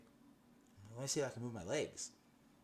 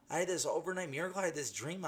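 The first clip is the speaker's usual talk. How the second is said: excited rush; 8 semitones higher, 3 dB louder, faster than usual. Retold or imitated. Excited telling of miracle.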